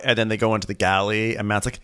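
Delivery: ordinary talk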